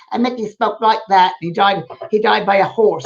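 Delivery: a lively Cockney lad's voice; Cockney guide. Retold or imitated. Medium imitates his Cockney spirit guide Mickey. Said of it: "a wonderful Cockney, uh, guide named Mickey".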